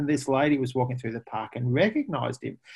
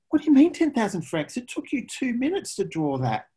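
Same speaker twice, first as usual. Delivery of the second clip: indignant lady; 9 semitones higher than usual. Retold or imitated. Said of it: "the lady said".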